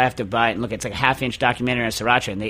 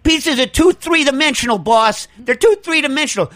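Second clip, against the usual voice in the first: mock employee; 10 semitones higher than usual. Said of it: "Harv, what do you got?".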